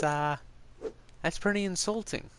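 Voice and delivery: ordinary talk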